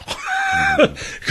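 high burst of laughter. Real, not acted.

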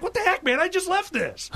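high-pitched mock exasperation; acted bewilderment. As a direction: imitate someone aghast, voice shooting up high. Acts out baffled Scottish columnist's outrage.

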